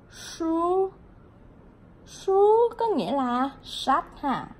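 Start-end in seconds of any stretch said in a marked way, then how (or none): 0.1-0.9 Su said with the tongue curled back, Mandarin shū; higher Su with a retroflex s. Shown as a demonstration. Teacher shows students to curl the tongue on Su. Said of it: "Cong lưỡi lên ha".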